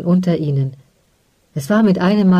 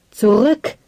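shout; 5 semitones higher than usual. A narrator's reading. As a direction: yell the retreat. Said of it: "schrie er".

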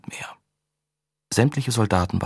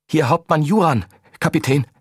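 breathless higher frightened voice; panting; 7 semitones higher, 5 dB louder than usual. Acted. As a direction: gasp it out in panic. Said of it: "keuchte eine andere Stimme".

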